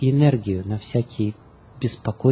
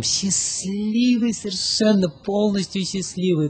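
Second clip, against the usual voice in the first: dreamy blissful; 7 semitones higher, slower than usual. Acted. Describing the blissful sadhu.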